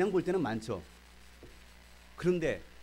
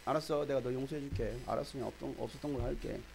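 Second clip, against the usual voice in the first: perfunctory forgiveness; 3 semitones lower, 7 dB quieter than usual. Retold or imitated. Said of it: "이런 형식적인 그런 거".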